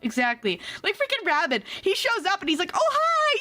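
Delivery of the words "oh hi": high, sing-out mock friendly greeting; hammed-up chummy greeting; all friendly. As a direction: imitate an over-friendly hello, pitched way up. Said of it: "being all friendly".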